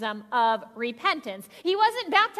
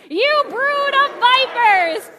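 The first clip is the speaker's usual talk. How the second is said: slow, loud, higher yell of brood of vipers; group yell; 9 semitones higher, 9 dB louder, much slower than usual. Shown as a demonstration. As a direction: yell it with the whole congregation. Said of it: "we're all gonna yell".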